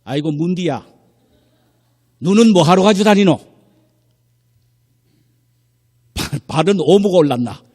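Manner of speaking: loud, high scolding in Gyeongsang dialect; Gyeongsang husband. Retold or imitated. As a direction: berate the wife like an exasperated Gyeongsang man. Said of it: "이 경상도 사나이가". (버럭 호통치는 경상도 사투리)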